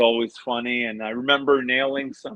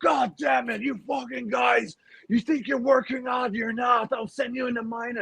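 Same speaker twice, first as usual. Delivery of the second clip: Tortorella impression; 10 semitones higher than usual. Player keeps impersonating his coach scolding the team, yelling. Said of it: "my Tortorella impression".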